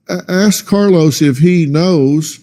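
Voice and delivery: ordinary talk, nothing marked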